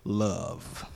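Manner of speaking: very deep, slow crooning voice; smooth bass love-man impression. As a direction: drop way down low and draw out the word.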